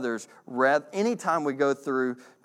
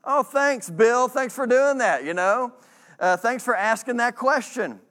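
higher mock gratitude to Bill; sarcastic thanks; 7 semitones higher, 4 dB louder than usual. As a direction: fake thanks, rolling the eyes.

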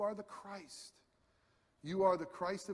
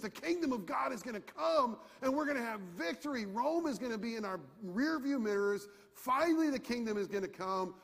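higher, louder, eager declaration; picture victory rising up; excited triumph; 5 semitones higher, 4 dB louder than usual. Retold or imitated. Prisoner [name] recalls his hopes, excited and higher.

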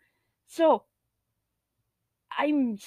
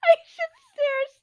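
very high, tearful squeal; crying with laughter; 13 semitones higher, 4 dB louder than usual. Real, not acted. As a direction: squeal the confession, helpless with laughter. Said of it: "we're crying laughing".

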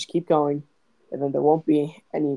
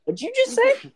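high-pitched, incredulous question; squeaky, startled double take; baffled disbelief; 3 dB louder than usual. Real, not acted. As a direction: ask it astonished, voice leaping way up. Baffled by a very long word.

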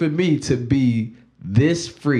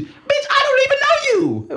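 mock retort. Retort to judging relative.

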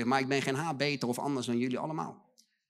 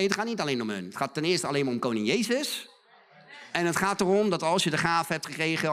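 ordinary talk, nothing marked

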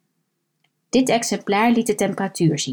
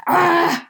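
loud, higher strained grunt of effort; effort shout; 10 semitones higher, 4 dB louder than usual. Acted. Old man heaving a heavy hatch, loud effort yell. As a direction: yell like a tennis player hitting. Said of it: "schreeuwde de man".

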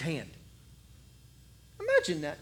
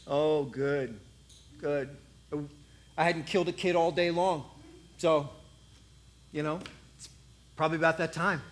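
lower, lazy, smug giant voice; mocking giant; 5 semitones lower than usual. Giant welcomes the kid, lower and smug. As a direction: sneer down at the tiny boy.

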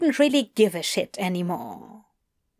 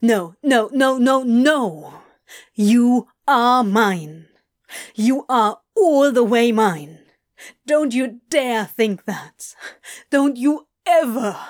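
forceful, emphatic, possessive insistence; fierce; 4 dB louder than usual. Acted. Crowley claiming Adam as his own, fierce. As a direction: hammer each phrase home. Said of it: "Crowley said fiercely".

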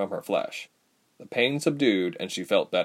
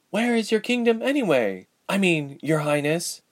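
high-pitched, pointed query, then corrected; higher voice, easing into deference; young heroine, questioning; 9 semitones higher than usual. A narrator's reading. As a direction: demand it, then remember your manners. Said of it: "quickly corrected herself".

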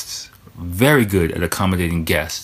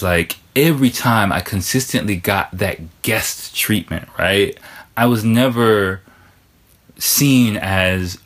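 ordinary talk, nothing marked

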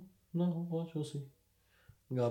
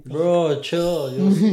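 loud protest; 7 semitones higher, 16 dB louder, much slower than usual. Real, not acted. Friends teasing about bought computers.

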